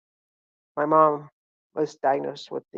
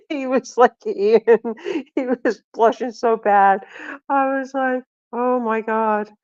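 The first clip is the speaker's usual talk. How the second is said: high, loud, cracking up while talking; real laughing speech; 7 semitones higher, 7 dB louder, slower than usual. Real, not acted. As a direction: push the words out through laughter. Cracking up at her story.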